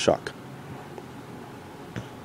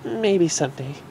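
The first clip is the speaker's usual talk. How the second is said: softer, smiling; wistful smile; 8 semitones higher, 5 dB quieter than usual. Acted. Derpy musing about the Doctor, wistful. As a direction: say it dreamily. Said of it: "she said with a smile".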